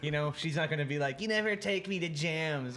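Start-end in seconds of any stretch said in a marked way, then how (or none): 1.2-2.8 imitated girlfriend's complaint, pitched up; mock complaint. Boyfriend imagines his girlfriend complaining about jams, mock complaint. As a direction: voice a nagging partner in a higher pitch.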